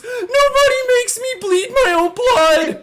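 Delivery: very high, loud, drawn-out scream; movie villain outburst. Acted. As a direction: scream it like an outraged movie character. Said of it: "that movie where the guy goes".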